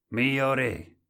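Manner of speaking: ringing announcement to the king; clear and loud. A narrator's reading. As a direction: proclaim it so everyone can hear. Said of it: "disse chiaro e forte".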